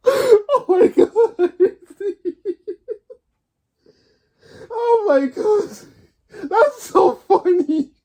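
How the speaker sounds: high, breathless words through helpless laughter; can barely speak for laughing; laughing hard. Real, not acted. Kid retelling a funny video to fans, cracking up.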